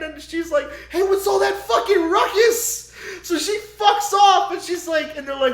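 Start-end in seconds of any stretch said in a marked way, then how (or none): 0.9-2.9 loud, high calling out; mock shout. Danny calling out about the noise behind the door, loud high shout. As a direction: holler it at the noise.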